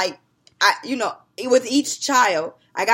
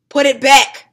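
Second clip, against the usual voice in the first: loud, high barked command; sharp mom order; 5 semitones higher, 14 dB louder, slower than usual. Retold or imitated. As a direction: snap the order at the girls.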